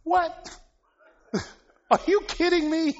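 hammed-up shocked apostle, laughing; astonished disbelief. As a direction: squeal it like you can't believe it. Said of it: "he's like".

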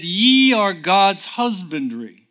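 preacher proclaiming the verse; emphatic verse. Acted. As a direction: proclaim "Ye are God's husbandry".

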